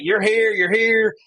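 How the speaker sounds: high, loud, drawn-out directing; excited coordinating. Retold or imitated. Guide assigning hunters their spots, excited and loud. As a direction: call out placements eagerly, stretching the words.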